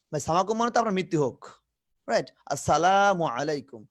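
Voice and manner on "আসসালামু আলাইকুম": slow "আসসালামু" with a long "laa"; drawn-out long vowel. Shown as a demonstration. Teacher corrects the shortened greeting, vowel fully stretched. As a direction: hold লা in "আসসালামু", then finish. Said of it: "টান না দিলেই কিন্তু গুনাহ হবে".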